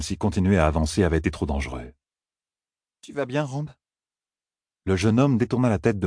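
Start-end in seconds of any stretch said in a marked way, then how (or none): 3.0-3.7 a higher, softer woman's voice asking carefully; Moiraine's gentle voice. A narrator's reading. Moiraine asks shaken Rand if he is hurt, soft and higher. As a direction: Moiraine checking on Rand, softly.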